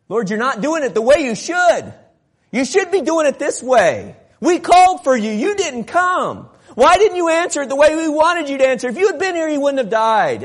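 high, loud, indignant complaint; angry tone. Acted. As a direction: reproach him as if he let you down. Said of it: "in an angry tone, kind of the way I'm portraying".

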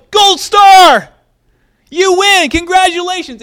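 loud, high, beaming game-show cheer; gleeful praise. Retold or imitated. Jesus cheering Peter for naming him, loud and gleeful. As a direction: hand out the prize like a thrilled host.

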